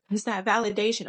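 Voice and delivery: normal talking voice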